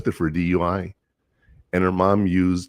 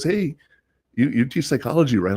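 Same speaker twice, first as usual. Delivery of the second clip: high, bright call of recognition; light imitation of a former student spotting his teacher; eager greeting; 8 semitones higher than usual. A former student calling out to his old teacher, excited.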